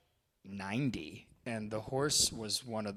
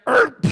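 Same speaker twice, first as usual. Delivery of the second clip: imitated horse gasp; 17 dB louder than usual. Horse collapsing sound imitated.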